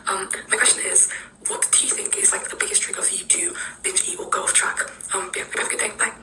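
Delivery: weak, voiceless rasp of someone ill; sick voice. Real, not acted. Sick fan leaving a question for Amber, hoarse and whispery. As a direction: talk through a lost voice, nearly whispering. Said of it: "I'm currently sick".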